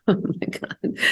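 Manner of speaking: breathy laugh through the words; laughing softly. Real, not acted. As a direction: laugh to hold back tears. Laughs off tears while telling.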